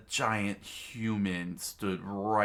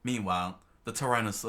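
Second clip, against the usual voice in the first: usual speaking voice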